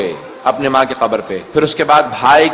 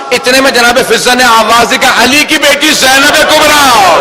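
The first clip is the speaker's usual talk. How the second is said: raised, high-pitched lament narration; impassioned mourning sermon; 8 semitones higher, 3 dB louder than usual. A narrator's reading. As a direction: build the tragic recital at a high pitch.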